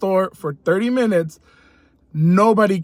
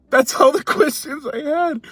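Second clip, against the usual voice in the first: laughing through it; 7 semitones higher than usual. Retold or imitated. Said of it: "I said".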